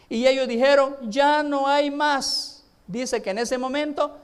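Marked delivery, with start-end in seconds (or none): 1.0-2.6 slow loud reply. Acted. Sons answer no more.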